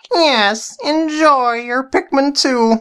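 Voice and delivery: high, sly villain voice; evil voice guy. Acted. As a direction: scheme like a cartoon baddie. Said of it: "why did you call me evil voice guy".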